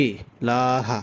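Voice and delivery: ইলাহা with a clear glottal stop before ই; hamza pronounced. Shown as a demonstration. Teacher showing the hamza must be heard, clear stop. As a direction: catch the throat before ইলাহা. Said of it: "এটা উচ্চারণ করতে হবে".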